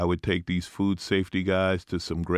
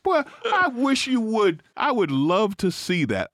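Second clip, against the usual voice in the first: higher, mock-threatening grandmother voice; playful higher grandma scolding a fool; grandmother's warning; 11 semitones higher than usual. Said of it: "They will slap you back with".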